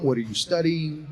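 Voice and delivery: usual speaking voice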